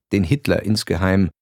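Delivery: ordinary delivery, nothing marked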